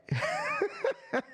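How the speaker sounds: sudden high-pitched burst of laughter; big laugh. Real, not acted. Streamer mocking a chat troll.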